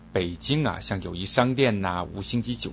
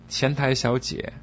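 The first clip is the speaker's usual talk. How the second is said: ordinary delivery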